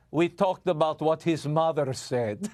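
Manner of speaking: mock Putin in a lightly put-on Russian accent; a hammy, half-hearted Russian accent; bad Russian accent. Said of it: "That was a really bad Russian accent".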